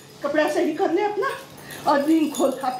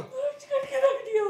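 higher, tearful wail through sobs; mother wailing at her daughter, higher and tearful; staged crying; 6 semitones higher, 4 dB quieter than usual. Acted. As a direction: cry it out like a betrayed mother.

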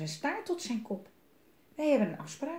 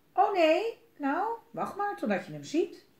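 mouse warning; 10 semitones higher than usual. Acted. Mouse warns owl.

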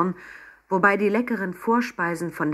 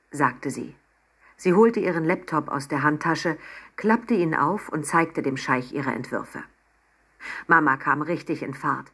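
ordinary delivery, nothing marked

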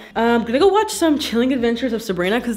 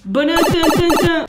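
a raised, louder sung-ish Mario jump jingle; Mario jingle sound; 6 semitones higher, 4 dB louder than usual. Retold or imitated. Showing off her Mario planter, she mimics the game jingle. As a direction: hum the little Mario tune for the camera. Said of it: "Mario's going, like".

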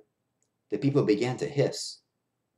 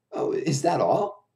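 laughing; 6 semitones higher, 3 dB louder, slower than usual. A narrator's reading. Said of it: "laughed the other clown".

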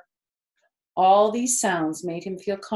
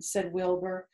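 plain reading voice

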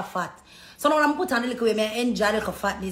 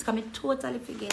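ordinary talk, nothing marked

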